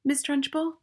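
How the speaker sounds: put-on high little-boy voice, rising; high, tentative schoolboy; small brave boy. A narrator's reading.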